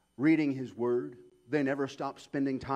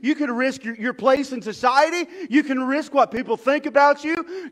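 loud and high, ending in a shout; shouting from the pulpit; full-force preaching; 13 semitones higher, 15 dB louder than usual. Acted. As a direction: hammer home every risk.

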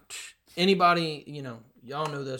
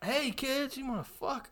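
very high, put-on voice; creepy spokesman parody; 10 semitones higher, 5 dB quieter than usual. Host joking as the disgraced sandwich spokesman, high put-on voice. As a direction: pitch it way up, softening at the end. Crude joke impression of Subway spokesman.

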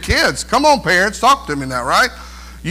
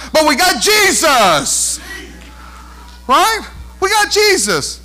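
rising proclamation; preaching at full force; 6 semitones higher, slower than usual. Real, not acted. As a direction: lift it and proclaim. Preaching climax.

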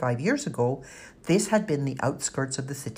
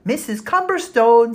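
louder, higher call; 9 semitones higher, 8 dB louder than usual. Acted. Kat calling Missus Cumberstone into the kitchen, loud. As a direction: call her from the doorway.

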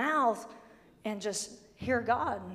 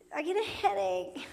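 whiny complainer; 6 semitones higher, 3 dB quieter than usual. Retold or imitated. Mocking complaining churchgoer.

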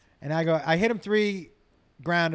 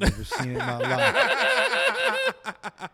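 laughing punchline; 5 dB louder, much slower than usual. Real, not acted. Punchline told slow through laughter.

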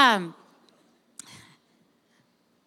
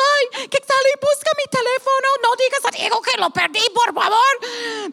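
high pleading voice, hushed at the end; pleading herself; 7 semitones higher than usual. Retold or imitated. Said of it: "Y yo digo".

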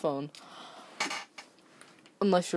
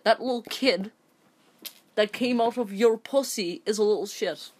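angry rant; 4 semitones higher than usual. Real, not acted. Ranting at the mother.

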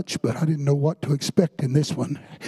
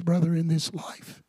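ordinary talk

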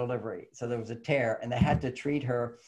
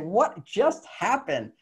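amazed wonder; 6 semitones higher, much slower than usual. Retold or imitated. His own amazement retold.

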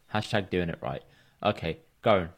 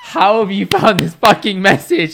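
higher, louder incredulous outburst; mock disbelief; 10 semitones higher, 12 dB louder than usual. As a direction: act shocked he dug it up. Incredulous at old message found.

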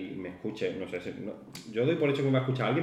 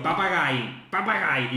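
higher-pitched mock yell; retold shout; 4 semitones higher, much slower than usual. Man shouting at the cleaner's back, high mock yell. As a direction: imitate a man calling out the word from behind. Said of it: "Me gritaba papagayo en mi espalda".

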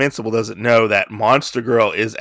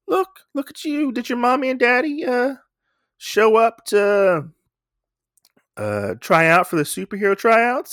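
high condescending put-on voice; mocking a kid; 12 semitones higher than usual. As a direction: talk down to the little girl, much higher. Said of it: "He's like".

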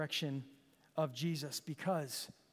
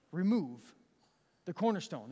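normal talking voice

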